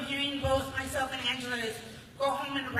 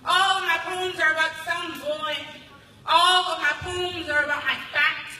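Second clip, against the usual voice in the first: louder, driving spoken word delivery; spoken word poem; 4 semitones higher, 6 dB louder than usual. Acted. Poet performing for the crowd, loud spoken word delivery. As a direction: pound out each line like a chant.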